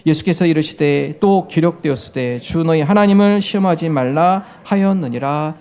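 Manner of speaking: slow, measured Bible reading; scripture read in unison. Real, not acted. Preacher leading the congregation in reading Matthew, slow recitation. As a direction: read it out slowly for a congregation to follow. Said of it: "마태복음 사 장 칠 절 함께 읽겠습니다".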